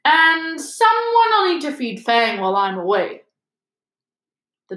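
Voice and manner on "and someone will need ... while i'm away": higher-pitched, pointed announcement; loud. A narrator's reading. Hagrid making sure hidden listeners hear, loudly. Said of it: "said loudly".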